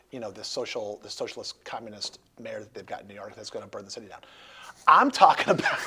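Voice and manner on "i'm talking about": suddenly loud and higher, breaking into a laugh; kidding burst of volume; mock outburst. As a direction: jokingly blurt it out loud. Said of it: "I'm kidding".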